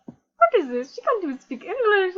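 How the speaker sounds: ordinary delivery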